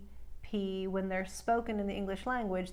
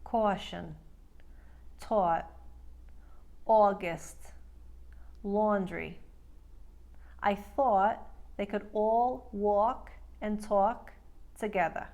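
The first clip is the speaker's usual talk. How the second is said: New York drawn-out, narrow aw; slow, careful New York accent demo; slower than usual. Shown as a demonstration. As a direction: glide off each aw like a New Yorker. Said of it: "follow along for practice".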